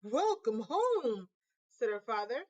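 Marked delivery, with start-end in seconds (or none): 0.0-1.3 slow, tender greeting; gentle welcome. Acted. Father greeting his daughter home, slow gentle welcome. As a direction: greet her kindly, taking time.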